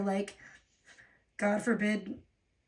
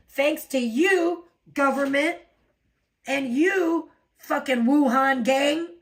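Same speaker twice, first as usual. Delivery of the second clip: slow, louder sarcastic thanks; louder, slow, sarcastic blame; angry rant; 3 semitones higher, 5 dB louder, slower than usual. Real, not acted. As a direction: drag it out with bitter sarcasm. Ranting at government.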